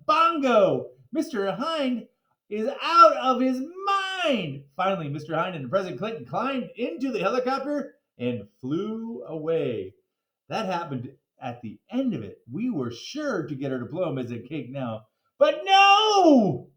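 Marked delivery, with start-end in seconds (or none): 1.1-4.6 high, loud, slowed cry of delight; excited. Acted. Excited narrator cheering. 15.4-16.7 loud high exclamation; sudden big reversal; dramatic surprise. Acted. Narrator dramatic twist.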